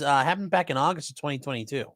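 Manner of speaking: ordinary talk, nothing marked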